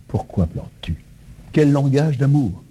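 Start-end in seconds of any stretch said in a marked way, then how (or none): 0.1-0.9 soft, low, caring question; tender question. Retold or imitated. Risen Jesus asking Marie, tender and hushed. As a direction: ask gently, as if leaning close. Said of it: "en disant".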